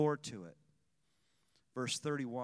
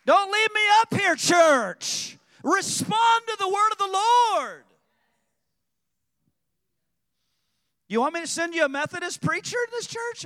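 rousing shout; 13 semitones higher, 11 dB louder than usual. Real, not acted. Preacher rouses the congregation.

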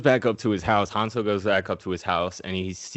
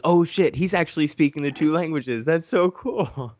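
higher, slowed-down mock awe; retold amazement; 8 semitones higher than usual. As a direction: replay your own delight in a put-on voice. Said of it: "And I was like".

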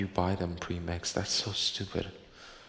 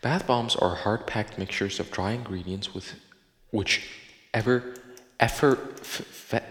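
usual speaking voice

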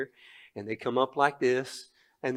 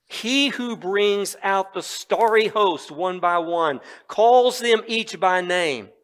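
raised, slowed preaching read; scripture reading; 5 semitones higher, 5 dB louder, slower than usual. Acted. Preacher reading Isaiah forty aloud to the congregation, rising proclamation. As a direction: proclaim the passage with rising force.

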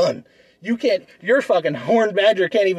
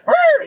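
a shrill cartoon growl, much higher and louder; high mock roar; 5 dB louder than usual. Acting the biting badger, high loud mock roar. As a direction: belt a silly beast roar.

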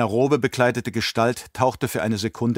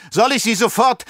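gloating baron; 11 semitones higher, 4 dB louder than usual. Acted. Baron gloats before being stung.

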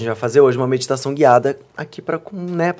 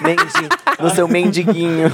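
squeaky silly voice; 5 semitones higher than usual. Acted. Playful word mangling.

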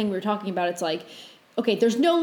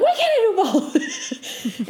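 high, drawn-out mock scream breaking into laughter; mock inner scream; 9 semitones higher, much slower than usual. Imitating how she screamed at herself in her head, high laughing mock scream. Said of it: "like, screamed at myself in my head".

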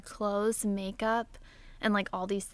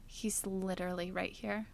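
annoyed dad; 3 semitones lower, 9 dB quieter than usual. Retold or imitated. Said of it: "from his voice that he was so annoyed".

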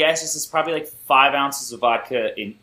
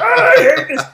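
much higher and louder, squawking Muppet complaint; Muppet Brock; 10 dB louder than usual. Retold or imitated. Imitating Brock flailing his arms, a high loud Muppet squawk. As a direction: flail and whine like an angry puppet.

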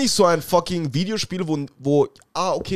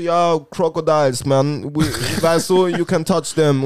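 slow, louder laid-back Jamaican voice; Rasta impression; 4 dB louder, slower than usual. Speaker imitating the Rasta guide offering crocodiles, slow laid-back Jamaican accent. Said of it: "kommt zu mir und sagt so".

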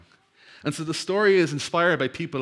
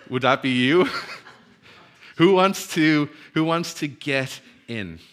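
loud, laughing question to the hearers; laughing challenge; 2 semitones higher, 6 dB louder than usual. Real, not acted. Preacher asks the congregation who gets in, laughing. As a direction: dare them through laughter. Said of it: "And there is silence".

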